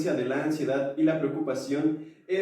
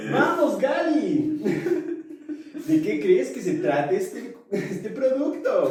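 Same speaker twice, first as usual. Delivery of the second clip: infomercial host voice; 8 semitones higher than usual. Pretend. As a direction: sell it like a late-night TV ad. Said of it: "Me sentí como infomercial".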